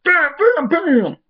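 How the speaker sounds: high, loud rapid gunshot imitations; mock gunshots. Retelling Jax unloading his gun, mock gunshots. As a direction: fire them off like shots. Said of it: "pulls out his gun".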